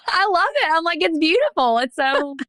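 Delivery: high-pitched, laughing delight; moved laughter. Real, not acted. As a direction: gush through a laugh, voice way up. Moved host laughs after confession.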